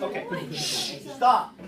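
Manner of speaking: loud shush and stop; hushing the class. Real, not acted. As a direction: shush them, then cut it off. Teacher hushing a noisy class.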